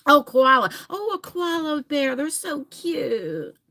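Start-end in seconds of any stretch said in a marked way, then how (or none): none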